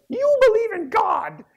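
put-on a three-year-old asking, high and unsure; daughter's question. Imitating his small daughter asking dad, high child voice. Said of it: "And she said".

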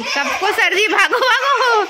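high, loud cries breaking into laughter; excited laughing shout. Real, not acted. Vlogger hurrying everyone out of the rain, shouting and laughing. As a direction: shout through giggles as you run for cover. Running from sudden downpour, laughing.

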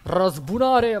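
loud, drawn-out battle shout; hammed-up vow of revenge; cry for vengeance. Vows revenge for killed allies.

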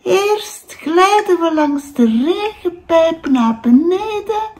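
high, bright little raindrop voice calling out; a cheerful raindrop calls to the boy, high and lively. Acted. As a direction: sing out like a tiny drop of water. Raindrop describes the journey.

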